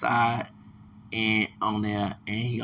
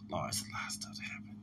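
sighing, whispered, much softer; weary whisper; 6 semitones lower, 14 dB quieter, faster than usual. Real, not acted. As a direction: breathe it out, worn down. Weary sigh over troubles.

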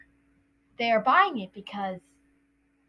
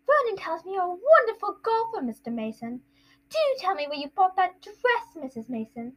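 high, gushing hostess voice; Aunt Petunia; 8 semitones higher than usual. Acted. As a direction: flatter the guests sweetly. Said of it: "it's Aunt Petunia".

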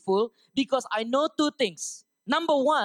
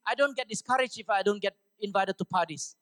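ordinary delivery

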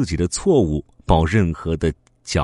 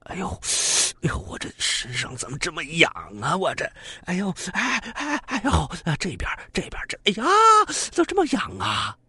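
high, quick fretful yelps while scratching; itchy and squirming; 13 semitones higher, 4 dB quieter than usual. Acted. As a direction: squirm and whine at the itch. Thief itching all over at night. (浑身发痒，尖声哼哼)

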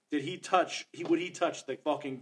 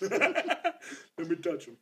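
laughing slow quote; 4 semitones higher, much slower than usual. Retold or imitated. Joking movie quote.

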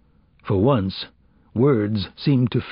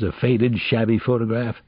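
ordinary delivery, nothing marked

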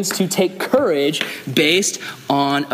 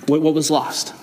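ordinary talk

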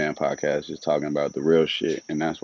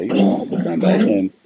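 much higher and louder tempted voice; 10 semitones higher, 6 dB louder than usual. Retold or imitated. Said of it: "I was like".

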